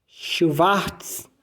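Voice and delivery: Schwarz, W said as V, soft; German Schwarz. Shown as a demonstration. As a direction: say Schwarz quietly, German style. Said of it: "Olha o W aqui com o som de V".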